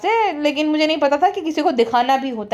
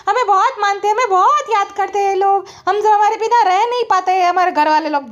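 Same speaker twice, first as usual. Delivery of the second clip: much higher, preening mock voice; mimicking boasting; 6 semitones higher than usual. Vlogger imitating the woman bragging how much family misses her, higher preening voice. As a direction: ape her self-satisfied bragging. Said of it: "और भालू कहती है".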